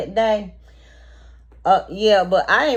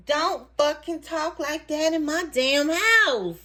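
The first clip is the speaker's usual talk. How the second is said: higher, drawn-out, emphatic reprimand; angry retold warning; 5 semitones higher than usual. Host slaps the table when guest repeats it, angry warning. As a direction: lay down the law, stretching out each word. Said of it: "I slapped my hand on the table like this".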